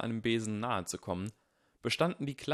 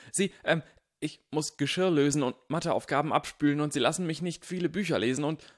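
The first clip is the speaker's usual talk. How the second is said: louder, higher rushed fibbing; nervous babble; 6 semitones higher, 6 dB louder than usual. A narrator's reading. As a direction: stumble through an invented list. Harry inventing complaints nervously.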